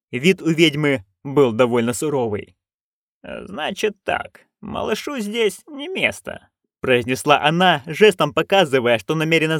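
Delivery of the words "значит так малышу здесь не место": slow, quiet, higher verdict; stern and quiet. Acted. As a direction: lay it down slowly and softly. Granny delivers stern verdict. (сурово, медленно и тихо)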